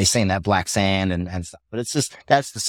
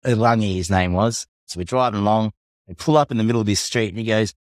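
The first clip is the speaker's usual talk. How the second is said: usual speaking voice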